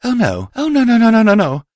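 high, loud rising panic; panicked. Acted. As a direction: babble in terror. Sees the danger, panics.

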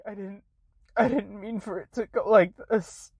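normal talking voice

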